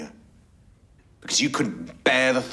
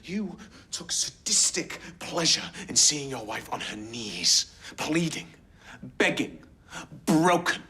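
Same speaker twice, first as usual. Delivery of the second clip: steadily rising indignation, pitch climbing higher; calm turning angry; 8 semitones higher than usual. Acted. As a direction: build from measured to outraged. Prosecutor builds closing accusation.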